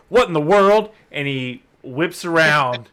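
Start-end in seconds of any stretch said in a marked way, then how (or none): 0.1-0.9 loud, high startled cry; startled shout. Acted. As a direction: jump at what he sees. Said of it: "And he goes".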